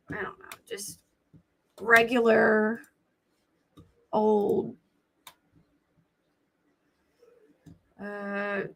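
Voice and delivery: scratchy, papery voice. Real, not acted. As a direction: let the voice scrape dry and thin. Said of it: "why my voice is so scratchy today".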